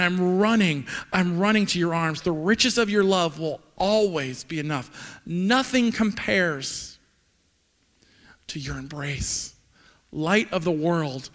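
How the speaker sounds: slow, drawn-out spoken lyrics; deliberate worshipful recital; reverent lyric recitation. Acted. Recites the song's closing lines.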